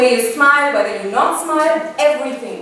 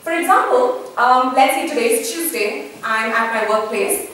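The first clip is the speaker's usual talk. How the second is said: ordinary delivery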